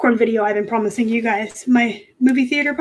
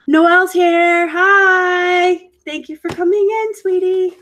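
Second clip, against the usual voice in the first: sweet greeting; 7 semitones higher, 4 dB louder, slower than usual. Real, not acted. Greeting a viewer who joined.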